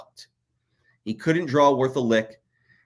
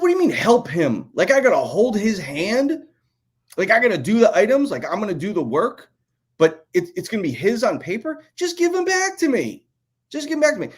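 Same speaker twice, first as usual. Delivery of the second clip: rising, incredulous protest, getting higher; exasperated retort; 6 semitones higher than usual. Retold or imitated. Telling the bosses off in disbelief, pitched up and exasperated. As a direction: push back in disbelief at the bosses.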